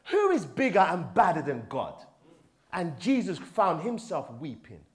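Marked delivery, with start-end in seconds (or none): none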